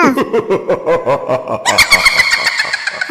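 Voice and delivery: low, soft sinister chuckle; witch laugh. Pretend. Witch laughs after waking child. (धीमी डरावनी हँसी)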